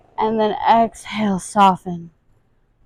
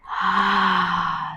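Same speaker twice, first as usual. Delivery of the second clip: audible exhale. Shown as a demonstration. Demonstrating breath with stretch.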